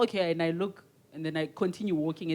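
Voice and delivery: ordinary delivery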